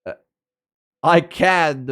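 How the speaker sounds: normal talking voice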